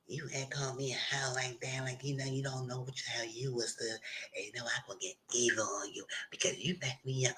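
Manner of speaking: hushed menacing threat. Retold or imitated. Retells a threatening curse.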